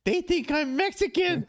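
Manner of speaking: punchline in the trainer's high Thai voice; imitating Thai trainer. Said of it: "he goes".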